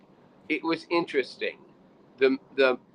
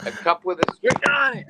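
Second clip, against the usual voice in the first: mocking echo; 10 semitones higher, 4 dB louder than usual. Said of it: "You sound like you're talking from a cup".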